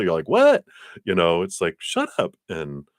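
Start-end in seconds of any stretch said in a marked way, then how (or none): 0.3-0.7 squealed high, loud disbelief; a giddy shocked yelp; shocked what. Retold or imitated. Acted stunned reaction to offer. 1.8-2.4 playful disbelief. Retold or imitated. Acted disbelief at good luck.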